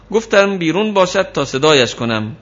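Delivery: normal talking voice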